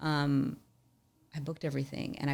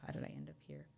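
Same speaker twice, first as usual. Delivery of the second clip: whispered to self; 16 dB quieter, much faster than usual. Retold or imitated. Asking myself on the pavement.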